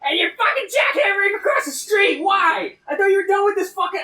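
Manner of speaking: real furious shout, high and loud; angry shouting. Real, not acted. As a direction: yell across at the noise. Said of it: "I'm so angry".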